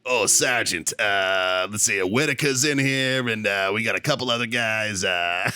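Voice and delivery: put-on dropped R's in a Boston-area accent; Massachusetts accent. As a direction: imitate a buddy's Lowell, Mass accent. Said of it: "Brown's from Lowell, Mass".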